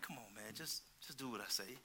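quietly insist, no fuss; Jesus, calm and low. Retold or imitated. Preacher voicing Jesus answering Peter, hushed and low. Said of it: "Jesus looking at him like".

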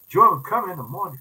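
normal talking voice

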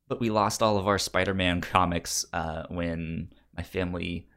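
normal talking voice

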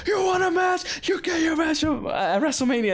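high shouted wrestler challenge; mock wrestler shout. Retelling the wrestler's challenge, high shouted mock. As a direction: bellow the challenge like a ham wrestler. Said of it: "And then after he's like".

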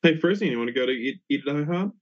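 ordinary talk, nothing marked

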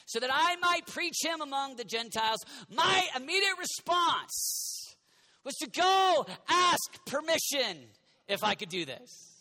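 rising to full-force preaching. Acted. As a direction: hammer the point home. Preaching build.

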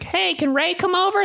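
put-on high childlike kid voice; kindergartener voice. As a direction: ask like a little kid. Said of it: "I'd be like".

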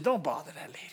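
low, hushed whisper; hushed retold warning; devil's quiet aside.

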